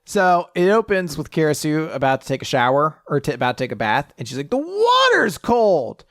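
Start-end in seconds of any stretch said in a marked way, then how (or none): 4.5-6.0 high, loud, drawn-out complaint; acted slow squeal of protest; mock wail. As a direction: stretch out a hammed-up cry of dismay. Acts out character's bathing complaint.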